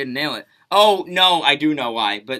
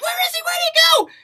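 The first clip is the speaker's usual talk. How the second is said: much higher searching call; 6 dB louder, much faster than usual. Retold or imitated. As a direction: shout it out, looking around for him. Girls searching, calling out.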